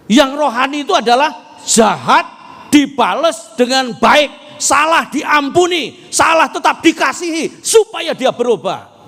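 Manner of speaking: loud, high, fervent sermon delivery; full-force preaching. Acted. Pastor urging the congregation to forgive, preaching loudly. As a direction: drive the point home to the congregation.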